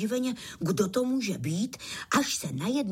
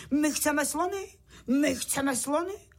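mock shouting; 6 semitones higher than usual. A narrator's reading. One bug at the circus demands elephants, calling out high. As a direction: clamour like an eager little spectator. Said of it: "Tak začal křičet".

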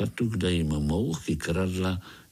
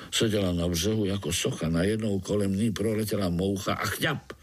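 plain reading voice

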